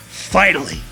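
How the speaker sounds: usual speaking voice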